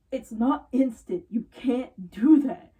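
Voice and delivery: slow exasperated emphasis; exasperated stress. Real, not acted. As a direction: lay it down slowly and firmly. Exasperated explaining to listener.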